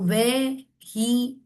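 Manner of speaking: vehicle slowly split into three beats; syllable by syllable. Shown as a demonstration. Teacher counting chin touches, vehicle syllable by syllable. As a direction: stretch vehicle as ve, hi, cle with gaps. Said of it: "three बार touch हो रहा है".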